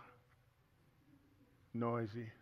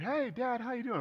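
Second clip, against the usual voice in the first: high, squeaky teenager imitation; pubescent weird voice; 11 semitones higher than usual. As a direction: put on a teen's breaking voice, way up high. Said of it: "you know, voices weird".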